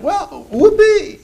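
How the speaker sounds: drawn-out high mock cheer; sarcastic whoopee. Preacher mocking the boast, high sarcastic whoopee.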